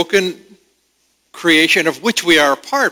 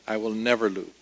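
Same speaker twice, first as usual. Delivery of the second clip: "never" stressed, the line falling much softer; 5 semitones lower, 10 dB quieter, faster than usual. Shown as a demonstration. Preacher repeating the phrase to the congregation, soft and stressed on "never". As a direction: drop the voice and lean on "never". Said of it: "We could emphasize that".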